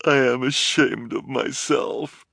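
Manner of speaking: slow, tearful confession in plain voice; unaccented, ashamed. A narrator's reading. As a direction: drop the accent, near tears. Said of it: "in an unaccented voice".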